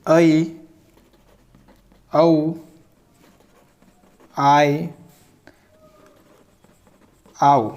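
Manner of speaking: slow dictation of the vowel sounds; sounding out the vowels. Shown as a demonstration. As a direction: dictate each sound separately. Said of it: "पहला नियम होता है".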